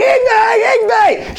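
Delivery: high, pleading fan yell; mock fan screaming. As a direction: imitate fans shrieking for their idol. Imitates waiting fans calling.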